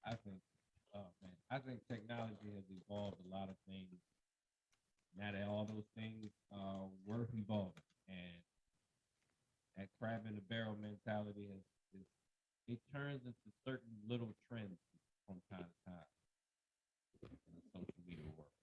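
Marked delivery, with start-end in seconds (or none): 0.1-8.4 mumbling. Real, not acted. Guest on a mic explaining technology, mumbling softly. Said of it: "I sound like I'm probably mumbling the whole time". 12.0-18.5 mumbling. Real, not acted. Guest on little trends in social media, mumbling. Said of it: "I sound like I'm probably mumbling the whole time".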